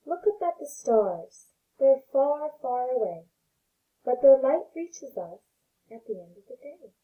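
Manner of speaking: gentle read-aloud voice for little ones; storybook reading. Acted. Teacher reading a picture book to her pre-K class, gentle storytelling.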